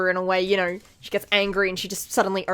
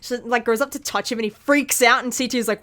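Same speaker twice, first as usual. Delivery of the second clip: ordinary talk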